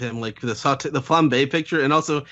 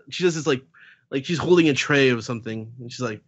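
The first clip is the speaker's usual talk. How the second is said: ordinary talk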